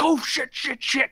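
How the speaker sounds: real alarm replayed; panicked swearing. The kid just hit the dashboard, panicked swearing.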